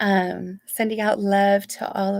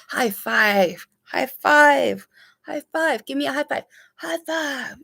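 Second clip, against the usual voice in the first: loud, higher repeated high fives; excited cheer; 6 semitones higher, 5 dB louder than usual. Real, not acted. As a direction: celebrate her with each one. Cheering a survivor.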